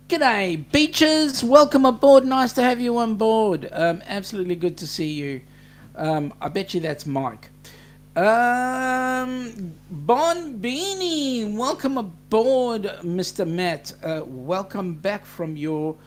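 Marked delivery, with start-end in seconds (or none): 0.1-3.7 much higher and louder, a big hearty hello; boisterous welcome aboard; excited loud greeting. Real, not acted. Greeting a chatter on stream. 8.2-13.0 drawn-out greeting. Real, not acted. Greeting a chatter on stream.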